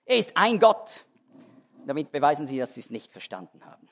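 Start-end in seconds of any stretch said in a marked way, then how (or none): 0.0-1.1 high, loud, mocking know-it-all voice; mocking Jehovah's Witnesses. Preacher mocking Jehovah's Witnesses' argument to his congregation, high and loud. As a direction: parody them as smugly triumphant, pitched up and loud. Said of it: "kommen die Zeugen Jehovas und sagen".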